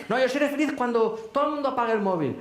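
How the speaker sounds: fast, high-pitched insistence; acted stubborn comeback, pitched high; stubborn protest. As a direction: rush out a mock objection, digging your heels in. Acted stubborn reply to himself.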